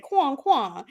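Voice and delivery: "Quong, quong" said with a French nasal vowel; French accent. Shown as a demonstration. Showing the cohost French duck sounds in a French accent. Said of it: "if you say it in a French accent".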